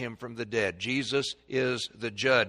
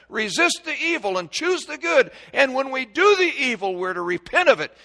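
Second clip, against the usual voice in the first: higher, louder repeat that hammers the point; preaching emphasis; 9 semitones higher, 5 dB louder than usual. Real, not acted. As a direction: preach it out, rising in force. Repeats the line with force.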